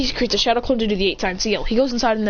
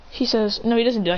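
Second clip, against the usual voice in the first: plain reading voice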